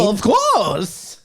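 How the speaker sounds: high, lilting Bane voice; Bane impression. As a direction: do Bane's odd sing-song. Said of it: "that was my Bane impression".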